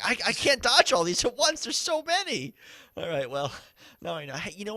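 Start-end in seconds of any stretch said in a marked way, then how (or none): 0.0-2.5 high, loud panicked retelling; mock panic at too many attacks. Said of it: "I was like".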